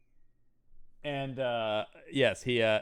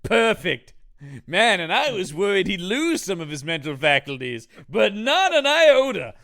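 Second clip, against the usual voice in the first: loud, high sarcastic rejoicing; hammed-up scientist's jubilation; mock delight; 5 semitones higher, 8 dB louder than usual. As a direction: build to a huge stretched punchline.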